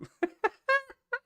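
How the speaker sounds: very high squeal of a laugh; squeaky laugh. Real, not acted. Laughing at his own laugh.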